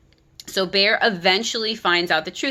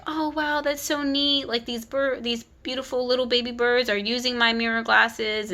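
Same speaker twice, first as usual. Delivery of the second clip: lightly voiced touched bear, higher and a little hushed; 4 semitones higher than usual. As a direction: imitate a tender, gently awed reaction. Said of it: "has such a soft, empathic heart".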